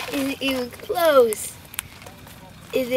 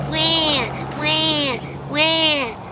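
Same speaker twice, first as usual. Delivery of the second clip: mock crying; 3 semitones higher, much slower than usual. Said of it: "Jenny was crying".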